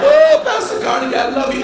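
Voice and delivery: high, loud, overjoyed cry of love; gushing host. Retold or imitated. Host gushing at Pastor Carnegie, loud and high. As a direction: gush over the pastor like a fan.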